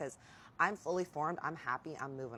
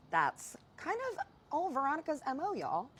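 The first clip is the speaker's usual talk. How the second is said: sassy; 12 semitones higher, 4 dB louder than usual. Real, not acted. Shading the woman who slept around.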